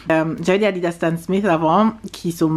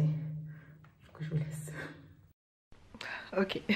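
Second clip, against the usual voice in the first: quiet; 17 dB quieter, much slower than usual. Real, not acted. Tired, fading sign-off outdoors.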